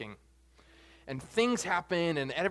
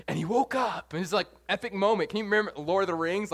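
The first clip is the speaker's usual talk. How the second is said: ordinary talk, nothing marked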